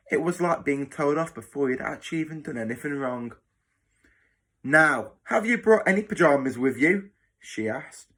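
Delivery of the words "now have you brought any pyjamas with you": louder, higher-pitched interrogating matron; strict matron. Acted. Matron questioning the new boy about pyjamas, stern. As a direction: question the child sternly and loudly.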